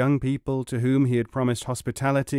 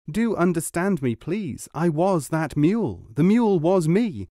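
higher, emphatic pleading; insistent plea; 6 semitones higher, 3 dB louder than usual. A narrator's reading. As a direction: plead to be understood. Pleading to be believed.

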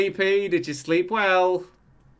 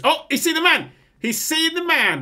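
excited; 9 semitones higher than usual. Real, not acted. Sees a stranger in the room.

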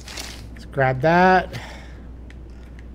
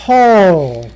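startled yelp; 3 semitones higher, 9 dB louder than usual. Real, not acted. Startled by monster.

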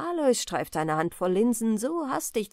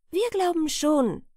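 put-on higher-pitched young maid calling across; 7 semitones higher than usual. A narrator's reading. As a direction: call out eagerly as a young maid. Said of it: "rief die junge Magd von ihrer Kunkel her". (rufende junge Magd, höhere Stimme)

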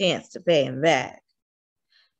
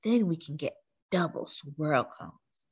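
whispering; 3 semitones lower, 7 dB quieter than usual. A narrator's reading. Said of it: "she whispered".

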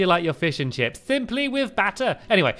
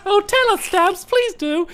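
mock eager plea; 12 semitones higher than usual. Mock begging to hear story.

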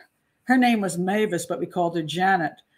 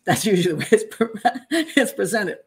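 laughing. Real, not acted. Laughing while explaining.

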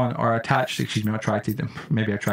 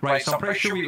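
normal talking voice

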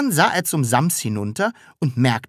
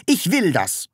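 higher, firm insistence; stern; 7 semitones higher than usual. A narrator's reading. Taschenbier answering the Sams's question, stern. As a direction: lay down the law. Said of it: "sagte Herr Taschenbier streng".

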